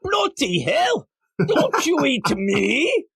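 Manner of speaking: high, drawn-out mock British wail; bad British accent. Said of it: "imagine it in a British accent".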